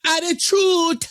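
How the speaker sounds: high, drawn-out sassy Attitude with a falling end; imitating a sassy girl, Attitude sung slowly downward; mocking sassy attitude. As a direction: stretch Attitude out slow, high and falling, mocking her sass. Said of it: "There was no reason for her to speak like this".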